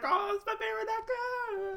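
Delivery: teasing friend imitates gushing fan; mocking gush. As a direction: squeal it like a starstruck fan. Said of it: "You were like".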